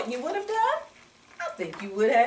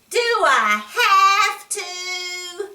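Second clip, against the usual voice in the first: high, slow, whining plea; whiny child; 9 semitones higher, 4 dB louder, much slower than usual. Acted. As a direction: drag it out like a sulky little boy. David whining at breakfast.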